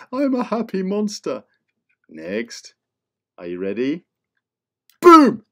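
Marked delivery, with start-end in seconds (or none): 0.1-1.4 much higher, bouncy happy monster; squeaky happy monster. Acted. Happy monster repeating itself to kids, high and giddy. 5.0-5.4 much higher, loud startling boom. Acted. Teacher revealing the next monster with a loud boom.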